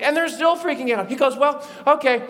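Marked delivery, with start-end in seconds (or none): 1.3-2.2 playful insistence. Acted. Jesus tries proving himself, playfully.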